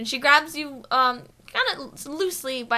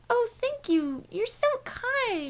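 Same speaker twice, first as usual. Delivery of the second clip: high, sweet, drawn-out gushing; gushing woman; 12 semitones higher, slower than usual. Acted. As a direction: play the woman thrilled by a compliment. Voicing the woman they meet.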